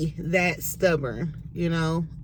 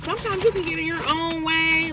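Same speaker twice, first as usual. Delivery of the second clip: higher mom voice; 10 semitones higher than usual. Retold or imitated. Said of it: "My mom always says that".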